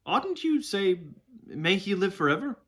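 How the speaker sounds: high-pitched, scandalised question; rather shocked. A narrator's reading. Boy correcting the horse's talk of the ruler, shocked. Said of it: "in a rather shocked voice".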